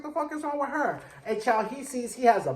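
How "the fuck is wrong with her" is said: baffled. Retold or imitated. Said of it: "Hawk's like".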